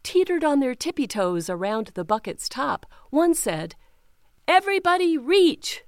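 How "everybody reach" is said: high, loud order; rally everyone to stretch; call to reach. Acted. A brother calling all the toads, high and loud.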